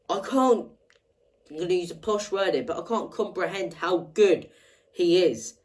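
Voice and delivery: ordinary talk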